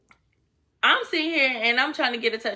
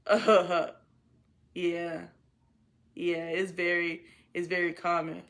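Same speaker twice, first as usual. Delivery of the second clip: man's low voice; 6 semitones lower, 5 dB quieter than usual. Retold or imitated. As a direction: imitate him, low and subdued. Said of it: "He goes".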